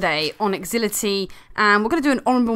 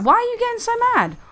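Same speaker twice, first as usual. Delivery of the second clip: high-pitched mock-innocent voice; put-on high whine of bewilderment; mocking imitation; 10 semitones higher than usual. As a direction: mimic him in a silly high voice. Mimicking the brother's clueless reply.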